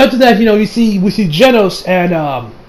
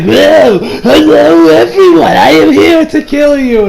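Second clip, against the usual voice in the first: high, slow, theatrical villain greeting; a cartoon monster announcing itself with glee; hammy frog villain; 8 semitones higher, 5 dB louder, slower than usual. Frog villain introduces himself.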